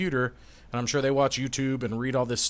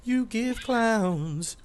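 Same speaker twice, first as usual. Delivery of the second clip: silly clown; 8 semitones higher, much slower than usual. Acted. Mocking clown voice.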